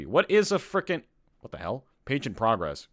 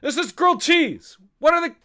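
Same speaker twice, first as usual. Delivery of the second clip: exasperation climbing to high, loud yelling; worked up; 6 semitones higher, 5 dB louder than usual. Real, not acted. Host furious that pudgy pies look like grilled cheese, worked up. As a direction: let the frustration boil over, higher and louder. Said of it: "I'm getting so worked up".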